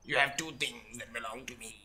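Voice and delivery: low, raspy imitation of a sinister caller; put-on raspy threat; raspy whisper. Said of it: "That raspy kind of whisper".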